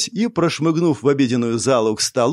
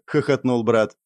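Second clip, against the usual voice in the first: ordinary delivery, nothing marked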